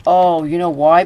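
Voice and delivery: excited aha moment; sudden realization. Real, not acted. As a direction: blurt out the answer you finally get. Sudden realization while playing.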